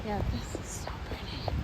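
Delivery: soft breathy whisper. Real, not acted. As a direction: hush it, almost no voice. Hushed remark at film location.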